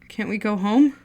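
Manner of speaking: pleading, voice rising on home; desperate repeated plea to go home. Acted. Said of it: "He pleaded".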